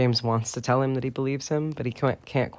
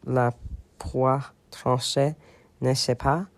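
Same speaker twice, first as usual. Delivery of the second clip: French. Shown as a demonstration. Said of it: "I don't remember how I said this".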